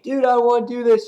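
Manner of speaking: fast, high, loud mock whine; hammed-up high-pitched reluctance; reluctant Superman. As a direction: play Superman whining he doesn't want to fight. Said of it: "Superman's like".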